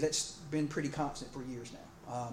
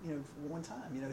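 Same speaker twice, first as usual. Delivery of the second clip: higher, quieter voice catching on to the trick; eager newlywed; 6 semitones higher, 7 dB quieter, faster than usual. Retold or imitated. Pastor voices the newlywed watching his friend, high and softer. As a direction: sound like a young husband who just figured it out. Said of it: "the newlywed guy was like".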